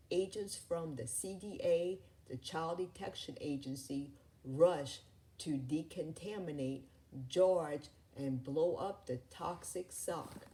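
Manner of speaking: ordinary delivery, nothing marked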